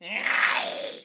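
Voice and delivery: short high airy goblin screech; high scream. Shown as a demonstration. Coach showing the position of his high scream. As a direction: hit a quick goblin-style high. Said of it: "if I do my high".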